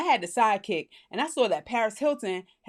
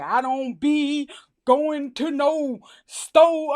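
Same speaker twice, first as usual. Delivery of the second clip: slow, laboured, louder caricature; mock old-slave dialect; 3 semitones higher, 5 dB louder, much slower than usual. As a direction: drag out each word in exaggerated mock dialect. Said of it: "They be sounding like some old slaves".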